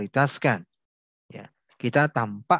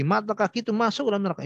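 brisk and stern, pitched up; stern fast warning; 8 semitones higher, faster than usual. Retold or imitated. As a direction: snap out the warning quickly. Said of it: "Ini sangat tegas sekali".

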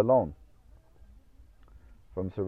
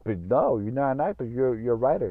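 higher, louder imitated protest; protesting objection; 5 semitones higher, 5 dB louder than usual. Filmmakers refusing to cast the writer as lead, protesting. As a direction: push back like an incredulous retold producer.

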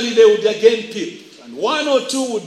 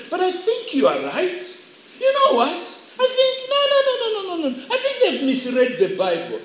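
high, squeaky put-on voice; church agreeing; 9 semitones higher, 3 dB quieter than usual. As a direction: give in with a high-pitched mock protest. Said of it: "begin to agree and say".

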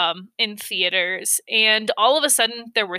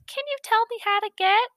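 much higher squeaky stranger voice, deliberately bad; high-pitched impersonation; 13 semitones higher, faster than usual. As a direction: imitate the shooter's high thin voice asking directions. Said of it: "So he starts off with".

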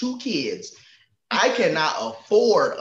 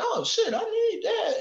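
much higher eager squeal; delighted surprise; excited. Retold or imitated. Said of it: "you be like".

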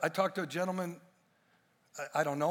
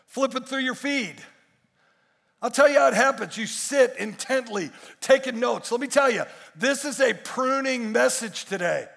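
loud, raised, driving sermon delivery; full-force preaching; 8 semitones higher, 11 dB louder than usual. Real, not acted. Preacher urging the congregation to come back and be pruned, full force. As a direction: preach it hard to the whole room.

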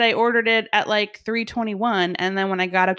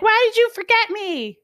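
high, louder mock wail of reproach; upset child, imitated; 4 dB louder than usual. Daughter left waiting at school confronts mom, imitated high wail. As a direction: imitate a hurt kid demanding an answer, way up high. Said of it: "she was pissed".

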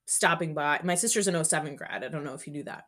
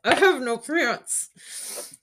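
high, slow dismayed wail; mock panic; 7 semitones higher, 5 dB louder, much slower than usual. New cadet to herself, a slow, high panicked wail. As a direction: stretch out the alarm.

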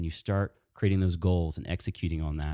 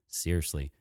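ordinary talk

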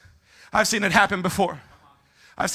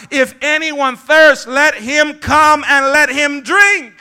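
loud, high, slow proclamation; crying out; 5 semitones higher, 8 dB louder, much slower than usual. Acted. Preacher quoting Jesus at the feast, crying out. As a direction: cry it out slowly over a crowd. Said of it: "Jesus stood up and cried out".

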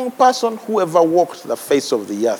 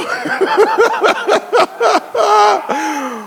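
long high laughter. Real, not acted. Preacher laughing with the congregation. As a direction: break into a high falling laugh.

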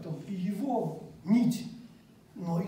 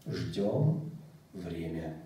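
dreamy distant voice; 13 semitones lower, much slower than usual. Retold or imitated. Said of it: "И голос во сне".

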